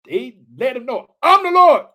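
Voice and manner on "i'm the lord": loud, high proclamation of the Lord; booming declaration. Acted. Preacher voicing God announcing himself, booming. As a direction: proclaim it with full force.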